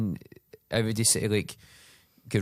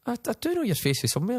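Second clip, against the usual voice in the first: unsure reply; 12 semitones higher, faster than usual. Retold or imitated. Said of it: "And I went".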